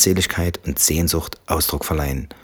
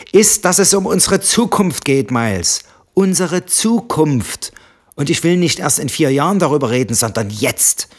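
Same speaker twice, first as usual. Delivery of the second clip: roared; 10 semitones higher, 4 dB louder than usual. Acted. Wife roaring over husband mid-sentence, furious. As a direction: cut him off with a roar. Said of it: "brüllte sie".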